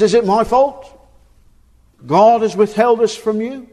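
hammed-up angry husband snapping back; retold snarl. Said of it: "snarls at her".

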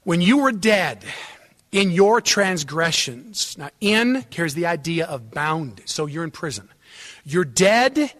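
loud, hammered scripture reading; emphatic preaching. Real, not acted. Preacher expounding Colossians to congregation, forceful. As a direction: drive each phrase home.

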